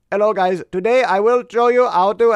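playful Indian accent impression. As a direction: put on an Indian accent like a coding tutorial host. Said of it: "you got the Indian accent there".

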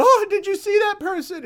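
very high, loud mock panic; hammed-up freak-out. Person on the street reacting to a passerby, hammed-up freak-out. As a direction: overact a melodramatic scare. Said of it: "the person all freaks out".

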